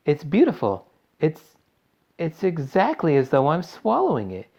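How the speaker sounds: high-pitched, gushing delight; rapturous. A narrator's reading. Violet relishes the blueberry pie.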